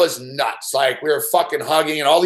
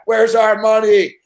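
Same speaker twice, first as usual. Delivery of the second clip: louder, higher, slow gleeful demand; 5 semitones higher, 5 dB louder, slower than usual. Retold or imitated. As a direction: demand the payout with swagger. Collecting winnings at casino.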